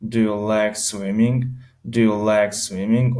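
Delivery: My birthday is in June را خیلی آهسته و شمرده بگو; کلمه به کلمه، آرام برای شاگرد; slow model reading. Shown as a demonstration. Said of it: "مثلاً میگه". (جملهٔ نمونه با سرعت بسیار کند)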